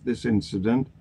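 normal talking voice

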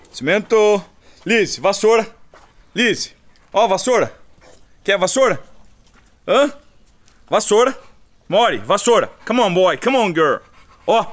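high, loud playful call; excited calling. Real, not acted. Owner waves a broom to excite his dogs, high and loud.

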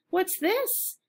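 put-on high, bright little girl's question; curious little girl. A girl finds the mouse's book, curious high voice. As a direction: lift way up into a curious child's voice.

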